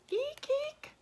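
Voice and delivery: tiny, very high-pitched squeak of fright; high mock-frightened "eek"; storybook squeal. A narrator's reading. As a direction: squeal as if spotting a spider.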